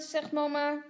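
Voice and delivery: ordinary delivery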